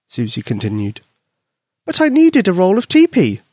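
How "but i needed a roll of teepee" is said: put-on squeaky high child voice; a little girl owning up. Susie admitting to her friend, high girl voice.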